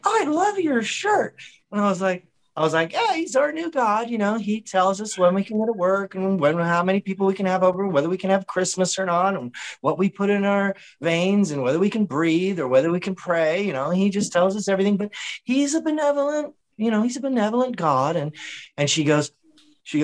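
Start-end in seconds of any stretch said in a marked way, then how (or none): 0.1-1.4 high, slow, mock-sweet compliment; mock sweet lady. Woman behind him in line gushing, high and slow. 2.9-18.2 higher, deadpan sarcastic praise. Retold or imitated. Mocking the Fauci fan in line, sarcastic. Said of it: "the I'm being sarcastic tone".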